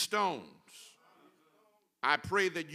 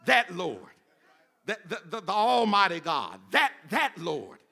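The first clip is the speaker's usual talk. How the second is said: louder, rhythmic, emphatic preacher delivery; fervent preaching; 6 dB louder than usual. Acted. Preacher proclaiming God's names to the congregation, emphatic preaching. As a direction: hammer each name of God with preacher's force.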